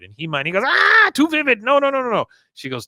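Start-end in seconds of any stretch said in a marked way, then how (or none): 0.6-2.3 high, loud recoiling no no no; high-pitched squeamish refusal; squeamish protest. Retold or imitated. Con voiced recoiling.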